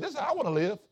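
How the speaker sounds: high-pitched retold delight; excited wishing. Pastor recalling first seeing how relatives lived, high excited wishing. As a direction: lift the voice high, relishing the discovery. Retells awe at richer living.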